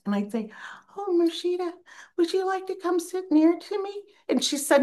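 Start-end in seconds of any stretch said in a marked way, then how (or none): 1.0-3.9 much higher, sweet and timid; soft-spoken young teacher. Retold or imitated. Young soft-spoken teacher inviting an old student to sit near, high and gentle. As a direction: coax her closer in a high gentle voice. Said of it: "very soft-spoken".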